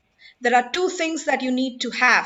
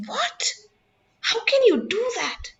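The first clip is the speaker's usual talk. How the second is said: sharp, much higher startled "What?"; surprised; 10 semitones higher than usual. Shown as a demonstration. Trainer demonstrating surprise to the audience, high startled cry. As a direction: fling the pitch way up in shock.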